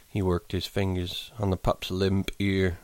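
ordinary delivery, nothing marked

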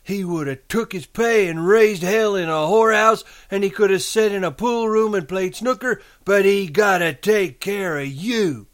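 high, loud reproachful rant; Lenny's voice. A narrator's reading. Imagined aunt blaming her nephew, high scolding. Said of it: "she went on in Lenny's voice".

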